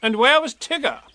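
a busy, fussy rabbit asking. Acted. Rabbit voiced.

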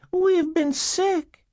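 put-on very high, slow little-girl voice; young girl. Girl answering caseworkers through the door, young child voice. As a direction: answer in a tiny child's pitch, drawn out.

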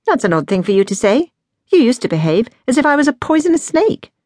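rising disbelief, pitch climbing; exclaiming. A narrator's reading. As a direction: exclaim at how odd this is. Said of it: "She exclaimed".

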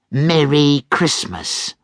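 slow sneering Merry Christmas; mocking. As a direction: drag it out with contempt. Scrooge mocks greeting.

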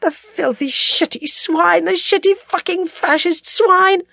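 high, drawn-out swearing through tears; tearful cursing. A narrator's reading. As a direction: curse steadily, half crying with rage. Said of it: "She cursed steadily, the tears streaming".

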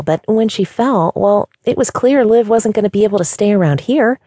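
high, bubbly rush of chatter; lightly voiced chatty young girl; cheery receptionist voice. A narrator's reading. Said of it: "She said all this very fast".

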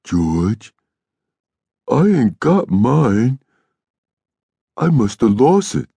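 put-on high, slow, childlike confession; gentle, worried Lennie. A narrator's reading. Lennie confessing to George it is lost, gentle. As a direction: admit it timidly, voice lifting. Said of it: "He said gently".

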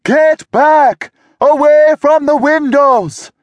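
high, loud warning yell; frantic shouted command. A narrator's reading. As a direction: bark the order to clear the windows. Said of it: "Florence yelled".

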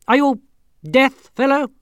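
high, loud commanding bark; barking king. Acted. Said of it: "he barked".

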